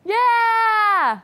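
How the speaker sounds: loud excited cheer; excited shout. Real, not acted. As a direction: shout it out, thrilled. Excited group cheer for challenge.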